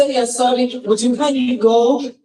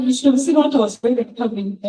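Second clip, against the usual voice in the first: ordinary talk, nothing marked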